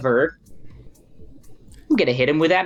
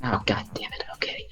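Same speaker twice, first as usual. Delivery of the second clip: breathy muttered curse under the breath; frustrated whisper; 3 semitones lower, 10 dB quieter than usual. Real, not acted. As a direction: whisper the swear to yourself, annoyed. Player frustrated by failed roll.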